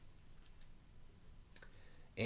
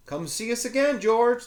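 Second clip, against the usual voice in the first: cheerful librarian; 13 semitones higher, 5 dB louder than usual. Acted. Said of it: "the librarian said, waving back".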